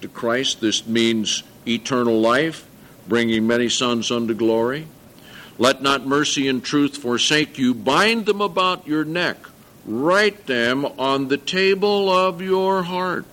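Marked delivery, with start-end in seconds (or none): 5.6-13.2 higher, slow reading of the verse; rising solemn recitation; scripture reading. Acted.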